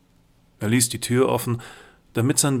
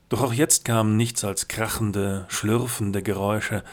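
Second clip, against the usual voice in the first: plain reading voice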